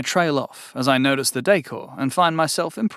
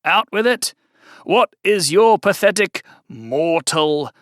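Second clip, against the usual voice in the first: louder, slow, grandly impatient; demanding fiend; 5 semitones higher, 4 dB louder, slower than usual. Acted. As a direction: bark it out like a bored demon.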